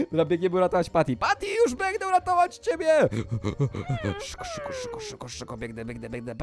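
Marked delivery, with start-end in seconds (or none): 1.2-3.1 heroic calling out. Pretend. Wolf promising Patty rescue, calling out. 4.2-6.3 very soft urgent self-talk; sneaking hush. Pretend. Wolf sneaking to the rescue, hushed.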